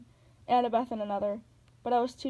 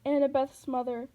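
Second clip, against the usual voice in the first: plain reading voice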